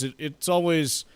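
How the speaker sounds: normal talking voice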